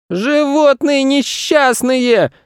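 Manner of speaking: high, slow squealed insult; boy screaming. A narrator's reading. Boy screaming at the animals across the zoo. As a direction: stretch it out in a squealing child voice. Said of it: "закричал он на весь зоопарк".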